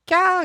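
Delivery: a sudden high-pitched squeak of delight; happy squeal. Retold or imitated. Viewer squealing at the lucky match, high squeak. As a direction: squeal like a thrilled fan.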